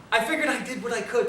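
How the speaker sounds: chuckling through the words; laughing. Real, not acted. Telling the audience his own effort, with a chuckle. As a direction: laugh it off as you say it.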